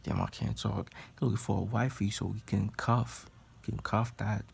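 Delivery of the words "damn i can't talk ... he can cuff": usual speaking voice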